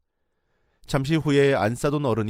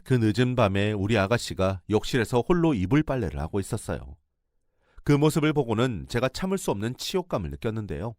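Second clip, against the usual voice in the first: plain reading voice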